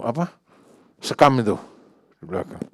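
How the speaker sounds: ordinary talk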